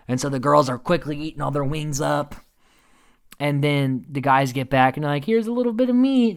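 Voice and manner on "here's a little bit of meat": high put-on voice, normal volume; hammed-up innocent offering; mock generous offer. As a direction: offer it in an imitating, pitched-up voice. Acted offer of scraps to guys.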